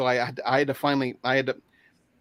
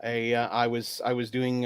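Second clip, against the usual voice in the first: normal talking voice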